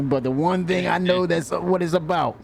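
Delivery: normal talking voice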